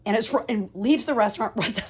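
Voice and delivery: usual speaking voice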